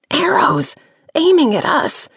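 high alarmed cry; alarmed warning. Acted. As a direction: warn them in panic. Wren spots arrows aimed at them.

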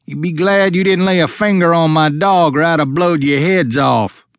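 high raspy country threat; raspy threatening stranger. A narrator's reading. Old man with shotgun warning trespassers, raspy threat. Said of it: "a raspy voice yelled from behind the trees".

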